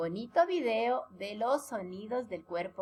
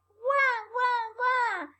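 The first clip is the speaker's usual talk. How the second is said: put-on high wailing wah wah of a baby; playful high baby wail; baby crying sound; 11 semitones higher, much slower than usual. Shown as a demonstration. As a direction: imitate a crying baby for kids. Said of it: "¿Y cómo hace el bebé?".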